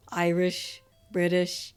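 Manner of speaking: ordinary talk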